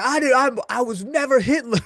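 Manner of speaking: high-pitched indignant denial; Rampage Jackson impression. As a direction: insist like an offended fighter. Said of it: "And he's like".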